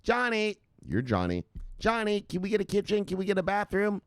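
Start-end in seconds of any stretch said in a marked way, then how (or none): 0.0-0.6 high-pitched wifely call; put-on high wife voice; imitated nagging wife. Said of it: "because the wife". 1.8-4.0 high, pleading mock-wife voice; put-on high wifely whine; imitated nagging wife. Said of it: "because the wife".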